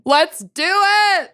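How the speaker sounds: high, slow eager kickoff; rally everyone to start; excited. Real, not acted. Kicking off the episode.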